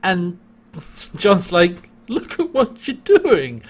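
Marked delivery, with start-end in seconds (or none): 2.1-3.6 laughing. Retold or imitated. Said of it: "like, cracking up".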